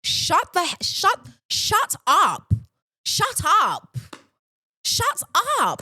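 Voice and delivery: high, climbing, fed-up shut up; exasperated shut up. Real, not acted. Ranting at the commenter.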